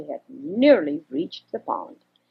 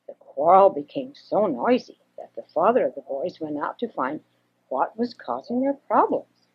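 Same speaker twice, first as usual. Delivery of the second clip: usual speaking voice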